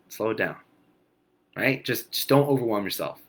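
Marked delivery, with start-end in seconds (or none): none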